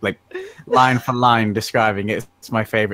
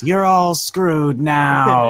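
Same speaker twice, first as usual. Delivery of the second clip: drawn-out, raised mock yell; imitated yell; 4 semitones higher, 4 dB louder, much slower than usual. Retelling Banner hysterically laughing at the stunned army, mock yell. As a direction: yell it like Bruce Banner, stretched out. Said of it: "then just yells".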